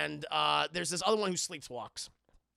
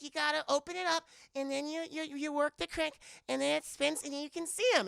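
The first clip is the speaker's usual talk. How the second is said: put-on high, eager child's voice; imitating a daughter explaining the toy; little girl voice; 8 semitones higher than usual. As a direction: explain like an excited kid. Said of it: "she's like".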